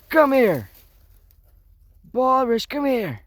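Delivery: high, loud call of a name; calling the dog. Real, not acted. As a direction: call Boris over from a distance.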